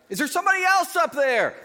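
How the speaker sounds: high, loud cry for someone else; desperate shout. Acted. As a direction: yell it up as the prophet. Habakkuk cries out, joke punchline.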